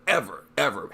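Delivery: usual speaking voice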